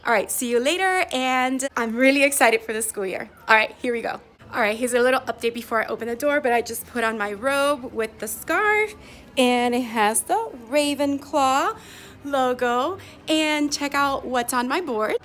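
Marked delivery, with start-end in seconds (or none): none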